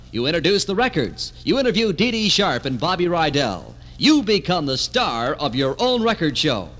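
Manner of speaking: ordinary talk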